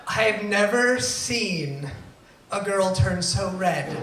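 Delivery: usual speaking voice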